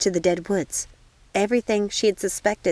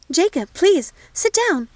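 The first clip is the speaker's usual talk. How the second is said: urgent, much higher and louder; alarmed cry; 10 semitones higher, 6 dB louder than usual. Acted. Jacob nearly falls.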